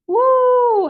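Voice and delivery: loud high howling woo; dog showing the cat how to howl, loud and high. A narrator's reading. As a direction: throw your head back and howl. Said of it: "howled Julia".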